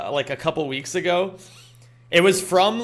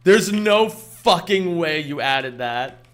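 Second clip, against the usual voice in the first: amazed disbelief; 3 dB louder, slower than usual. Real, not acted. Thrilled by a new emote.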